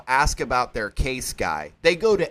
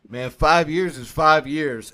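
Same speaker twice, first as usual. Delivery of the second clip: slow, blunt; 6 dB louder, slower than usual. Retold or imitated. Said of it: "he goes".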